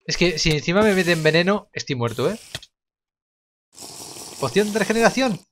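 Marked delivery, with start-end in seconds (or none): none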